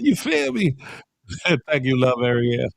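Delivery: ordinary talk, nothing marked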